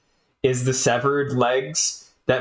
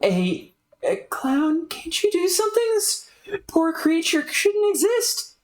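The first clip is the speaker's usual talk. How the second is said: much higher, anxious plea; higher voiced fretful girl; pleading Jane. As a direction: beg the clown to help. Jane begs the clown.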